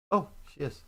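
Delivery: ordinary delivery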